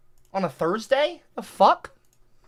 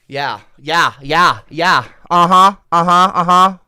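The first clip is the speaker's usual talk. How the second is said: mocking dismissal; 9 dB louder, slower than usual. Mocking chat with loud taunts.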